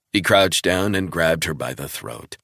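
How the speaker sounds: ordinary talk, nothing marked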